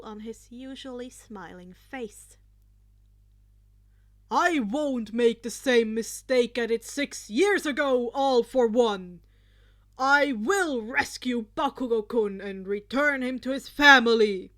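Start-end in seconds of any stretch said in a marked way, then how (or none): 4.3-14.5 loud, fierce heroic declaration; All Might shouting his vow to rescue Bakugo; growled vow. Acted. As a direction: roar the promise at the villain. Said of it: "he growled".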